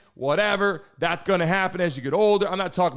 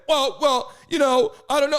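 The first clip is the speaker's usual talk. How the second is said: mocking hesitation; 5 semitones higher than usual. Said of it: "what do they say".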